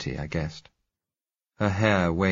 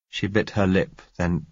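usual speaking voice

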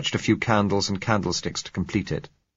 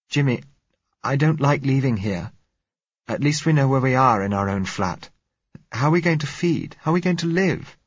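put-on higher, pleading young woman's voice; frightened girl pleading; 8 semitones higher, 3 dB louder than usual. A narrator's reading. Girl begging Jimmy not to leave their flat, frightened and higher. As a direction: beg him not to leave, rising worry.

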